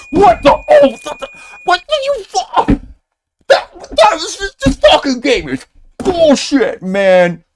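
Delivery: high-pitched, loud hammed-up rage screaming; fake gamer rage. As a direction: ham up a rage fit at the game. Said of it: "Let me try to pretend like I'm raging".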